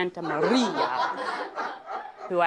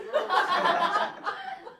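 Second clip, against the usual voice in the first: high rising laugh. Real, not acted. Laugh after wallpaper joke.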